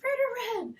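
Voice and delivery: put-on high excited squeal of a kid; kids shouting excitedly. Kids at recess spotting the mascot, high excited shout. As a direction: shriek the name like a kid at recess.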